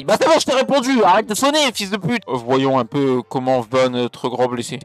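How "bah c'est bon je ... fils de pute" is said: voix qui monte très haut, agacée; cri exaspéré. Real, not acted. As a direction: engueule celui qui sonne, d'une voix perchée. S'emporte contre une sonnerie insistante. (exaspération criée dans les aigus)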